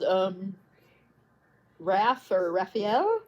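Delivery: ordinary talk, nothing marked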